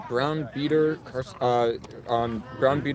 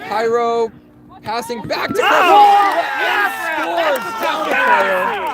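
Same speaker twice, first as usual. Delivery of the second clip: higher, louder shouted call; excited goal call; 13 semitones higher, 7 dB louder, slower than usual. Real, not acted. Commentator calling a goal.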